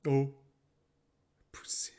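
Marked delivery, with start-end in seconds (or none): 1.5-1.9 whisper. Real, not acted. Whispered word.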